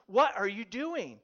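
loud, slow, drawn-out demand; exasperated wife. Acted. Wife repeats herself to husband wearing AirPods, exasperated.

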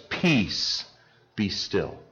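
quiet, settled command fading away; calm command. Acted. Jesus rebuking the storm on the water, calm quiet command. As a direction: speak it like a calm master stilling the sea. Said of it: "He says".

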